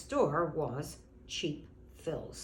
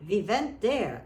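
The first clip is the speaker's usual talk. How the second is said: put-on went said as vent in a Russian accent, loud and high; 4 semitones higher, 8 dB louder than usual. Neighbour Anna remembering Russia, in a Russian accent. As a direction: imitate Anna's Russian accent, We vent there. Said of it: "speak in her accent, saying".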